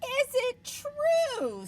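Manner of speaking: high princess voice. Acted. Princess sweet-talking the dragon, high and slow. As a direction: lay on the flattery.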